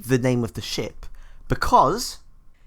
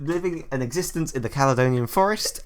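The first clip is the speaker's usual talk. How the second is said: usual speaking voice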